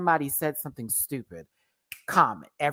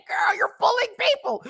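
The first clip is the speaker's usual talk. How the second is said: laughing high quote. Retold or imitated. High mocking quote while laughing.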